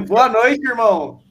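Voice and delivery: put-on light Bahia accent greeting. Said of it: "com sotaque baiano".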